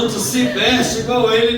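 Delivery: high, loud accusing voice; loud accusation. Acted. Accuser confronting Peter, loud and accusing. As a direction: expose him before everyone.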